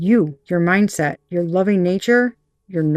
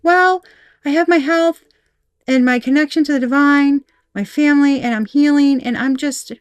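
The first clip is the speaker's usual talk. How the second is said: much higher, bright breezy voice that softens toward the end; all cheery; 6 semitones higher than usual. Retold or imitated. The querent shrugging off hardship, breezy and cheery. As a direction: play it carefree, nothing can touch you. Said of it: "you're just all cheery".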